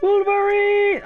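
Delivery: high, drawn-out mock battle cry; movie battle cry. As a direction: stretch it out high like a rallying call. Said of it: "I love that reference".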